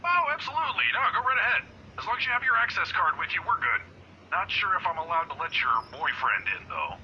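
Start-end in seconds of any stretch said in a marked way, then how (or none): 0.0-3.8 high-pitched, cheery go-ahead; eagerly obliging. Acted. Guard letting employee upstairs, eagerly obliging. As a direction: wave her through gladly, pitch lifted high.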